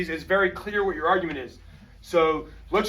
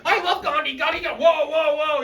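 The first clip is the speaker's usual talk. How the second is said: high-pitched overexcited student; mock over-eager outburst, high and rushing ahead; fired up; 9 semitones higher than usual. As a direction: blurt it out, all fired up and carried away. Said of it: "you get all fired up".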